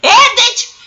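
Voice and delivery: high, loud defiant yell. Retold or imitated. Cousin yelling back at the caller, loud and defiant. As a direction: fling it out in triumph. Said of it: "She said that shit".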